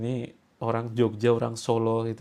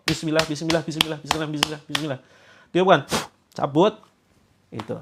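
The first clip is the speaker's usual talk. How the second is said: ordinary delivery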